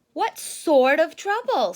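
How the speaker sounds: hammed-up worried Mama Bear; Mama Bear asking. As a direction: press the question like a worried mom. Mama repeats Sister's question.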